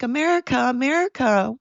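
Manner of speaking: high, whiny begging voice; mock them begging for help; mock pleading whine. Mocking weak countries begging America for help, high whine. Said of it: "And they're like".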